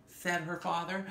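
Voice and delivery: plain reading voice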